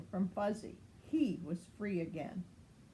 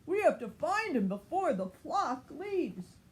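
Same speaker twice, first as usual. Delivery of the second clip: higher, pressing plea; urgent pup plea; 7 semitones higher, 4 dB louder than usual. Acted. Marshall telling Ryder to hurry, higher urgent voice. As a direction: press for help now.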